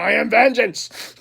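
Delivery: slow, high, loud hero declaration; imagined yell. Pretend. Vlogger imagining yelling out his car window, mock-heroic shout. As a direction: holler it out the window, hammed-up and drawn out. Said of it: "I'm putting the window down".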